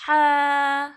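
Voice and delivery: loud throaty ہا from mid-throat; demonstrated ha. Shown as a demonstration. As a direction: push ہا from the middle of the throat. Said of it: "کیسے پڑھنا ہے؟".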